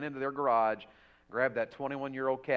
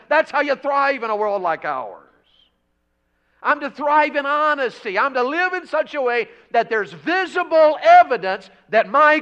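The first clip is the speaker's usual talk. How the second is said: high, loud pulpit shouting; full-force preaching; 12 semitones higher, 14 dB louder than usual. Real, not acted. Preacher charging believers to thrive in holiness, full-force preaching. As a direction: proclaim it to the whole church at full volume.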